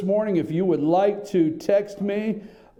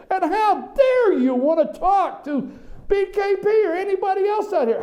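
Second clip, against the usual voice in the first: mock outrage; 11 semitones higher than usual. Host mimicking press elite scolding newcomers, mock outrage. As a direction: play the affronted elitist, pitched way up.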